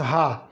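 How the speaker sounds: "H" said as "ha", with an aspirated onset; double-R H sound. Shown as a demonstration. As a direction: say "H" like Portuguese double R plus a. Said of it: "Tem um som de dois R's".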